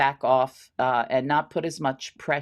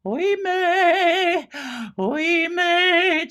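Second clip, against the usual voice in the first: high, far-carrying melodramatic "Oimè"; overdone wailing; 12 semitones higher, 5 dB louder, much slower than usual. Shown as a demonstration. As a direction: wail "Oimè" high and overacted. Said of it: "you don't have to deliver it like".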